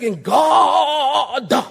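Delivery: loud, high, drawn-out pulpit cry; preacher voice. Retold or imitated. Preacher mocking pulpit habits to his congregation, hammed-up preacher voice. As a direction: parody a preacher, tacking "uh" onto word ends. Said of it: "you change the whole voice".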